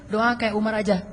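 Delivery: usual speaking voice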